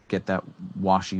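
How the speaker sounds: ordinary delivery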